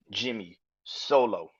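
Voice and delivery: ordinary talk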